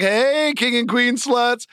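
high, stretched-out echo of a greeting; back-room staff calling. Retold or imitated. People in the back greeting the couple, mock drawn-out call. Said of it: "the people in the back are like".

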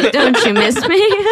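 hammed-up seductive plea through laughter; badly faked sexy coaxing; mock sexy. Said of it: "I'll try sexy".

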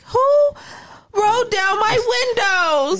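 shocked high squeal; indignant high-pitched question; outraged surprise. Retold or imitated. Retold outraged discovery.

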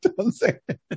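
laughing high; cracking up protest. Real, not acted. Cohost breaks up laughing.

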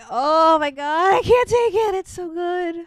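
can't-take-it emotional outburst; overwhelmed. Real, not acted. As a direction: let it overwhelm you as you say it. Overwhelmed by the sad scene.

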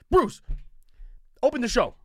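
high, loud barked order; boss giving orders. Retold or imitated. Boss voiced in story.

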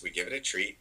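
ordinary talk

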